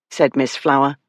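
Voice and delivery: usual speaking voice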